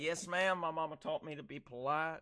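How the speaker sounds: mannerly country Southern charm; Southern accent. Retold or imitated. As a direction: answer politely in a put-on Southern accent. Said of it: "when you do your Southern accent".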